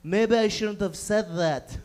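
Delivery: low, slow, timid regret; vulnerable backpedaling. Acted. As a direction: back down meekly and slowly. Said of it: "you feel vulnerable. You feel like".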